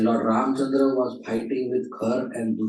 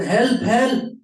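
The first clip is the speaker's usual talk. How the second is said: high-pitched retold cry for help; raised, urgent storyteller's shout; panicked shout; 12 semitones higher, 4 dB louder than usual. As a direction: act out a panicked call for help. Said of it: "and she shouted".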